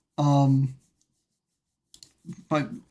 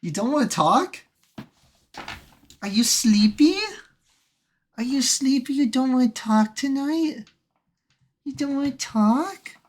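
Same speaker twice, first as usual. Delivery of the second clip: higher, sing-song questions to a dog; coaxing pet talk; 10 semitones higher, 3 dB louder than usual. Real, not acted. Streamer to a sleepy dog that won't speak, high coaxing. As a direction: coax a pet sweetly, pitched up.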